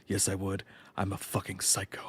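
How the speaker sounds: soft, low confession to himself; whispered to himself. Retold or imitated. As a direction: say it under your breath, deadpan. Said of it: "I whisper to myself".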